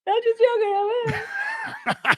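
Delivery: high, drawn-out mock whine; laughing, wailed fake excuse; mock pleading. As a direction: plead as if being dragged out. Echoes the mock plea.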